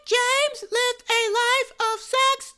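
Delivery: put-on very high, drawn-out kid voice; loud, squealed-out presentation in a put-on high voice; mock kid presenter. Mock kid presenting a fact to the club, high drawn-out voice.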